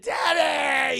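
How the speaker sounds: long, loud, high mock wail; anguished cry. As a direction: howl for your father, hammed up. Son cries over dead father.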